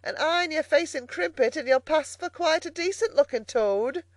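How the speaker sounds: high-pitched mocking call. A narrator's reading. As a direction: carry the taunt on, high and carrying. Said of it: "she called out".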